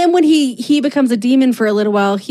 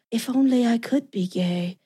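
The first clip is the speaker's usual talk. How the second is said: soft, wistful sigh of a line; 8 dB quieter than usual. Retold or imitated. Dean gazing off screen, wistful and soft. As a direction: murmur it like a secret longing. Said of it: "looks off screen wistfully".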